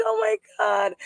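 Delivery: reliving the moment of shock; amazed exclaim. Retold or imitated. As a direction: squeak it out in disbelief. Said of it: "I was like".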